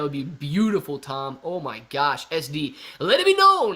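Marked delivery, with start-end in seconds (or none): 3.0-3.8 much higher and louder, like a herald; grand proclamation. Acted. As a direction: announce it to the whole kingdom. Grand declaration to the chat.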